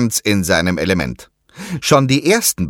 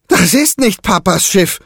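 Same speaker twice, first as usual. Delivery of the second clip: high, louder cry of alarm; horrified cry; 12 semitones higher, 4 dB louder than usual. A narrator's reading. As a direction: cry out in horror at the ship. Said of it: "ruft Diego sichtlich entsetzt".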